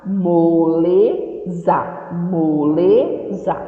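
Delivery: "Moleza" very slow, each syllable clear; slow syllable model. Shown as a demonstration. Teacher models the word for children, very slow. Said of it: "teacher models word for class".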